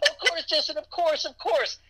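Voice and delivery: very high, gushing fangirl imitation; mock an eager fan close to happy tears; overjoyed crying fan. Fan agreeing to stream for [name], mock overjoyed and high. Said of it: "always crying out of happiness".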